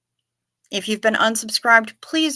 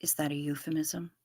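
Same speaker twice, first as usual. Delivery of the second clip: soft conspiratorial whisper; whispered aside; 5 semitones lower, 12 dB quieter than usual. Real, not acted. Teasing Anne about her sticky donut, sly whisper. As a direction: lean in and whisper the tease.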